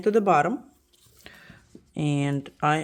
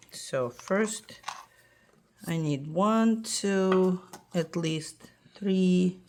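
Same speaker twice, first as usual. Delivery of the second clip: normal talking voice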